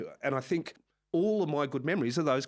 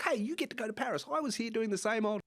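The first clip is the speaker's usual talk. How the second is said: scolding friend; 10 semitones higher than usual. Retold or imitated. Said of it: "he gave me a big talking to".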